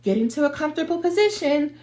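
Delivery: lightly voiced meditation guide, pitched high; a hint of sing-out meditation host. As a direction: gently mimic a meditation instructor in a high voice. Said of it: "put that music on and they're like".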